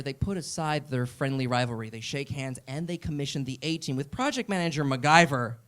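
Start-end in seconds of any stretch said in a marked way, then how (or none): none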